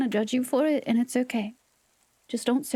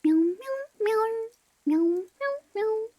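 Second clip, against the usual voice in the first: playful meowing; 8 semitones higher than usual. Pretend.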